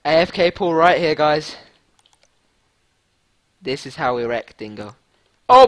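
usual speaking voice

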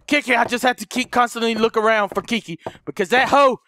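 ordinary talk

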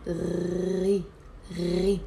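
"ri" with a long throaty gargle before the vowel; gargled ghain. Shown as a demonstration. Teacher adding a vowel to ghain, gargled "ri". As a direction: draw out the r of "ri" in the throat. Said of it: "add the e to it".

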